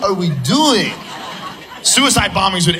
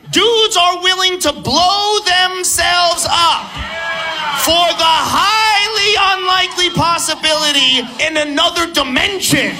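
incredulous rant; 8 semitones higher, slower than usual. Acted. Ranting about bombers, high, slow.

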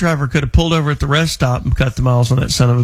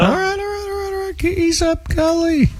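high squeaky mock retreat, much higher; mock defensive squeal; 13 semitones higher than usual. Host mocking caller's preaching with a high squeaky retreat. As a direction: back off in a high, hammed-up squeal.

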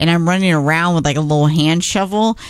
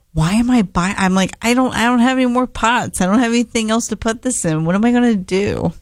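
mock fretting; 7 semitones higher than usual. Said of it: "I'm just like".

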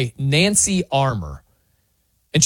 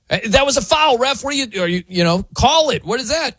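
higher-pitched mock complaint to a ref; mock yelling; 8 semitones higher, faster than usual. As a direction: protest a call like an aggrieved player, half in earnest. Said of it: "is yelling at officials".

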